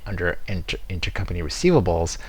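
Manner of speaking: normal talking voice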